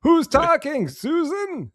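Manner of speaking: put-on high, loud, cranky old-man yell; loud high old-man holler that drags at the end. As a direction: shout it like a confused grumpy grandpa. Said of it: "He forgets Heidi's name".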